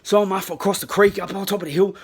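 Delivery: plain reading voice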